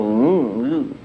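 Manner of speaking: low sliding synth bass ooh; imitated synth bass. Shown as a demonstration. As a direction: make the Moog bend, low. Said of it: "it's like a stick where you can go".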